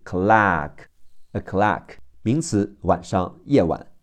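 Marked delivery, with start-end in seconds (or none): 0.1-2.0 American open "ah" vowel in clock; American pronunciation. Shown as a demonstration. Teacher shows learners the American pronunciation of clock, American accent. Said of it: "美式发音是".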